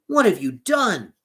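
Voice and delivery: Little Klaus shouting at the innkeeper; shouted. A narrator's reading. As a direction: fling it at him as the door bursts open. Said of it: "shouted Little Klaus".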